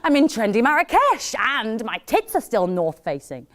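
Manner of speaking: high, loud hammed-up squeal, easing towards the end; mock bragging. Made-up city break post, mock bragging. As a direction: crow it like a show-off.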